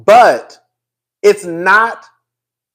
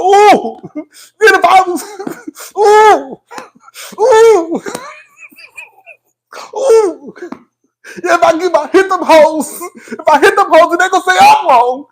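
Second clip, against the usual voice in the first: mock tantrum, high-pitched wailing; hammed-up pissed-off whooping, far above normal pitch; 10 semitones higher than usual. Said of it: "Bitch, [name] was pissed".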